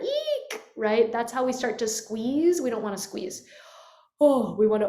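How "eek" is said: much higher tight squeak; squeezed eek. Shown as a demonstration. Teacher demos a high larynx, squeezed eek. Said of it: "a high larynx that's up here".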